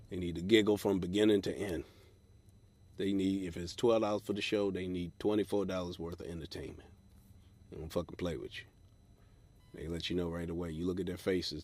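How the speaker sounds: ordinary delivery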